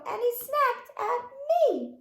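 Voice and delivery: high, loud sudden snap; squeaky surprise snap at me; playful mock snap. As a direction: make the kids jump with it. Playful snap climax of rhyme.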